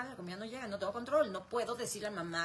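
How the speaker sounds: usual speaking voice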